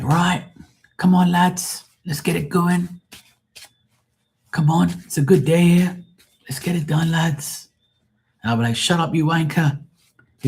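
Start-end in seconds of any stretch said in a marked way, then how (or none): 0.0-7.7 high-pitched Liverpool foreman rallying the lads; Liverpool accent. Retold or imitated. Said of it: "he was from Liverpool in England". 8.8-9.8 high-pitched mock English heckle; mock English accent. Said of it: "You sound just like a damn Englishman".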